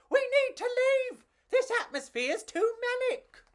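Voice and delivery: very high, loud bug yell; panicked yell. Acted. Pedro yelling to escape the sliced calzone, high panicked yell. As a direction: shout to flee the pizza. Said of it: "Pedro yelled".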